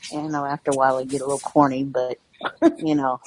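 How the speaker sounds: normal talking voice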